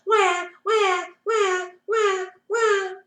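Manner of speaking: put-on high wailing baby cry; high-pitched mock baby wail. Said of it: "The baby cried even louder".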